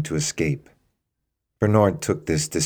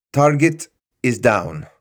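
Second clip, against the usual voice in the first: clipped radio report; 7 semitones higher, 5 dB louder than usual. Acted. Guard reports into earpiece.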